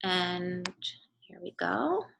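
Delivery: ordinary talk, nothing marked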